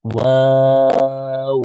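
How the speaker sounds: ওয়াও held long and very slow; stretched waw. Shown as a demonstration. Teacher showing kids how long to hold ওয়াও. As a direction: draw ওয়াও out for a full count. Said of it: "drawn-out letter demo".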